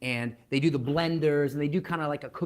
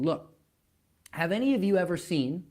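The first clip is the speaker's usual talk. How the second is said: ordinary delivery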